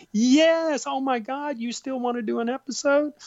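very high, astonished Southern voice; surprised Southern squeal; Junie's Southern accent. Retold or imitated. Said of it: "she has this wonderful Southern accent".

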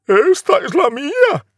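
very high, loud grasping voice; snatch the claim like a spoiled sister; shrieking mock stepsister; greedy stepsister.

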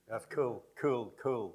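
"cool" with oo sliding toward ee; fronted vowel. Shown as a demonstration. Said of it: "oo is almost the same".

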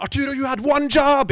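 high, loud panicked yell; inner scream. Retold or imitated. Memory screaming inside at the forgotten line, panicked yell. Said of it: "hukommelsen skriker innvendig".

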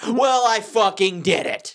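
triumphant brag, each word stretched; proud announcement. Real, not acted. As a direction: crow about it, slow and proud. Proudly announces he kept reading resolution.

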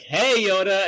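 loud cheery call. Retold or imitated. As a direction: call out across the room. Obi-Wan calling out to Yoda.

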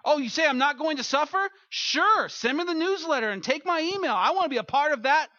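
a gullible fan rushing to sign up; mock eager signup. A gullible believer signing up for a no-suffering promise.

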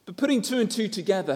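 usual speaking voice

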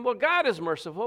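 ordinary talk

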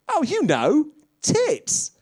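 very high, loud imitation; mock high-pitched reply. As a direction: squeal the answer in a put-on voice. Voices the laughing people's reply.

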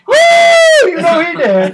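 very high, loud whoop then a gloat; mocking a cheering fan in the theater. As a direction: cheer it out in triumph. Imitating cheering crowd.

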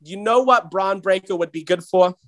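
normal talking voice

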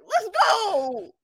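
high, laughing cry of let's go; excited cheer. Retold or imitated. Cheering her free drink, laughing and high. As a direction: whoop it up, laughing with joy.

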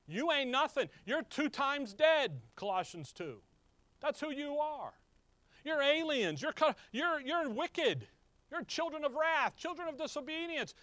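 high, driving preacher's rebuke; fiery preaching. Acted. Preacher rebuking his Gentile listeners, fiery and high. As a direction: hammer home their sinful state.

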